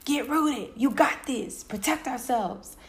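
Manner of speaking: high and loud, bossing herself; tough-love shout to herself; fierce self pep talk. Retold or imitated. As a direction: hype yourself up hard. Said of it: "And I was like".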